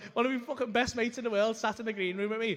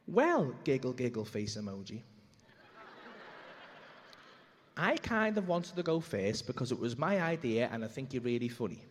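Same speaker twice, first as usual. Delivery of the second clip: deliberate, lower-pitched reading with long pauses; verbatim message readout; 7 semitones lower than usual. Retold or imitated. Comedian reveals wife's reply word for word, measured deadpan readout. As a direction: read it out deadpan, letting each part land. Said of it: "tell you the message that came back, word for word".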